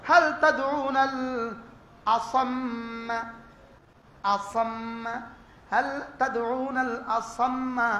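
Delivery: slow, measured Arabic recitation; Quranic recitation. Acted. Preacher quoting scripture to listeners, slow recitation. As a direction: recite the verse with slow reverence.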